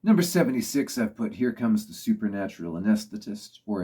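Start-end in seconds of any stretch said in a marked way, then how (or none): none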